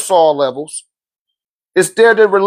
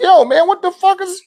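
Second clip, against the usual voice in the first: high astonished blurt; amazed; 12 semitones higher than usual. Retold or imitated. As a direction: cut off mid-question in shock. Father amazed at typing.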